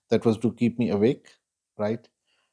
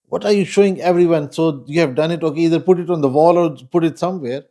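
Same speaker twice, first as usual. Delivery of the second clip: retold motherly scolding, higher and louder; annoyed mom; 6 semitones higher, 5 dB louder than usual. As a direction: imitate an exasperated mother telling off her child. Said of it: "my mom got, uh, annoyed".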